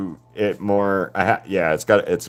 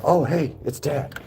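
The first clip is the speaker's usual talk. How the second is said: dog's voice; 8 semitones higher, 3 dB quieter than usual. Retold or imitated. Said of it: "he was like".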